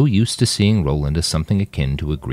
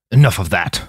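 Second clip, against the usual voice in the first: angry; 4 semitones higher, 4 dB louder than usual. A narrator's reading. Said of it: "Donald Ferris sounded angry".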